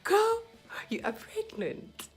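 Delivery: joyful repeat. Retold or imitated. Doctor repeats.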